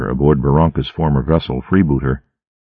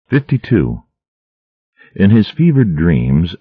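usual speaking voice

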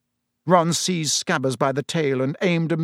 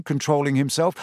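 plain reading voice

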